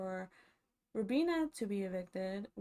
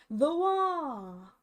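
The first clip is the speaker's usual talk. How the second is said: big announcer reveal, much higher and louder; hyped announcement; 6 semitones higher, 10 dB louder than usual. Real, not acted. Announcing the classic competition to viewers, loud and hyped. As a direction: sell it like a game-show reveal.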